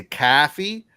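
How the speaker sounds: "caffey" with an open "caw" vowel, New York style; mock New York "caffey"; New York coffee. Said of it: "instead of saying coffee, they say".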